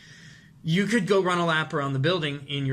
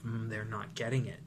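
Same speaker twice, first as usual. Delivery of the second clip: a quiet, worried murmur to yourself; anxious inner thought; 5 semitones lower, 8 dB quieter than usual. Retold or imitated. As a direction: dread creeping in, softer. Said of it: "that terrifying moment of".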